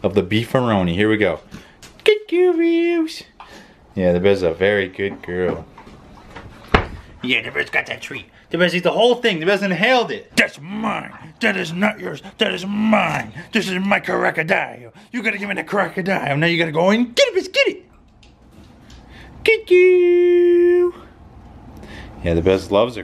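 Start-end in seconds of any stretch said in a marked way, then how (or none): 2.0-3.2 very high squeaky thank-you; dog's squeaky voice. Pretend. Voicing the dog thanking him, high squeaky. 10.4-17.9 possessive dog voice, a bit higher, ending in a squeak; voicing the dog. Voicing the dog claiming her crocodile, mock sassy. 19.4-20.9 playful squeal. Real, not acted. High loud playful.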